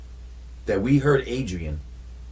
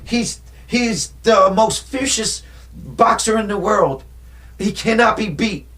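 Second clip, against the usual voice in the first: boastful trainer; 7 semitones higher than usual. Retold or imitated. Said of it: "Remember that?".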